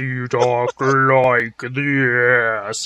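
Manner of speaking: low, dragged-out slow-motion voice; slowed-down playback. Shown as a demonstration. Joking about slowing her friend's reading in post, slow-motion voice. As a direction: stretch every word like slowed tape. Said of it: "slow that down in post".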